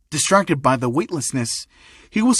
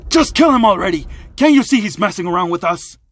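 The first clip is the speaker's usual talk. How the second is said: loud, high-pitched exasperated order; frustrated; 4 semitones higher, 4 dB louder than usual. Acted. Thug boss orders the kid killed already, frustrated. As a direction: lose patience with your underling. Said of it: "visibly frustrated, would say".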